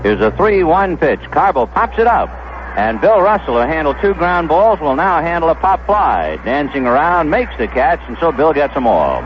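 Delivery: ordinary talk, nothing marked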